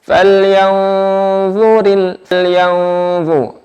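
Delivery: slow, chanted Quranic recitation. Shown as a demonstration. Teacher models the verse for students, slow chant. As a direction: recite it slowly, each letter placed.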